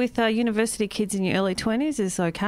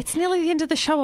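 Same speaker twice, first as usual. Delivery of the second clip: rushed; 7 semitones higher, faster than usual. Real, not acted. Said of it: "speak quickly".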